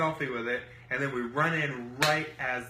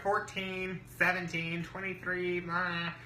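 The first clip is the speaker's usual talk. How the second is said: higher, lightly voiced roll call; mimicked score callers; 6 semitones higher, slower than usual. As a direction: rattle off others' scores, pitched up. Mimicking other tables' scores.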